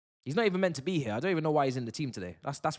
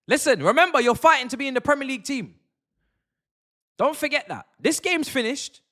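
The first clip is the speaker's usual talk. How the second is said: loud, high shout at the players; rallying shout; 10 semitones higher, 9 dB louder than usual. Real, not acted. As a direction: fire the team up, loud and high. Urging the players on.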